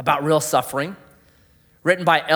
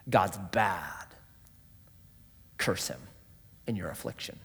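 low tempting voice; 9 semitones lower, 13 dB quieter than usual. Acted. Voicing Satan's whisper to believers.

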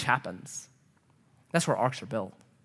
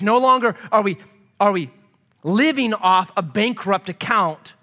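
emphatic preaching; 3 semitones higher, 5 dB louder than usual. Real, not acted. Preacher rejects the bankrupt account, loud and emphatic.

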